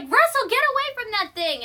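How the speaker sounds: high, loud urgent warning; acted-out alarmed shout. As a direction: call someone away from danger. Carl warns boy away from bird.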